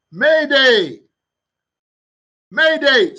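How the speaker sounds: urgent distress call, loud and rising; shouting. Acted. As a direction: shout it like a panicked intern calling for help. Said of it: "here's the intern shouting".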